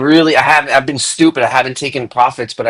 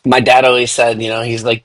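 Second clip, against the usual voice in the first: ordinary delivery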